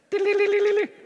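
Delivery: very high, tinkly fairy trill. Shown as a demonstration. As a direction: flutter the sound up high like a tiny fairy. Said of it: "let's try that again".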